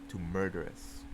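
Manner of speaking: ordinary delivery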